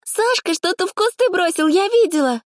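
very high, squealing tattle. A narrator's reading. Tiny brunette tells on a student, squealing. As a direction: squeal it out at the top of the voice range. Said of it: "взвизгнула довольно громко".

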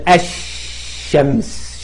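الشمس with a very long held sh, slowly; doubled sun letter. Shown as a demonstration. Teacher showing shadda, الشمس with prolonged sh. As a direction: drop the l and stretch the sh in الشمس. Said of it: "الحرف اللي بعد اللام مشدد".

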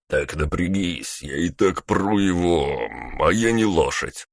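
breathless, almost shouting; panting half-shout while hauling; out of breath. Acted. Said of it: "задыхаясь, почти крикнул первый".

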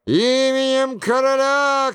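high, ringing official demand; thundering shout. A narrator's reading. Крильон at the abbey doors demands entry, thundering. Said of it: "кричал Крильон громовым голосом".

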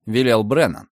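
usual speaking voice

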